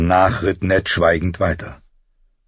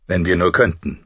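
ordinary delivery, nothing marked

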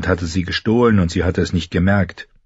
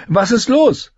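put-on much higher girl's call; Arya calling; 3 dB louder, slower than usual. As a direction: call after the running boy. Arya running after boy. (hoher Mädchenruf)

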